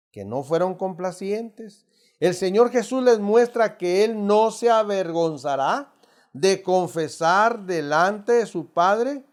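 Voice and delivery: ordinary delivery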